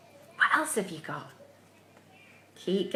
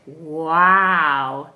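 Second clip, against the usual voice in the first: a lower, louder amazed wow; modelled wow; 7 semitones lower, 7 dB louder than usual. Shown as a demonstration. Teacher modelling an amazed wow for the kids. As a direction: say wow for the children to copy. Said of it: "Can you say".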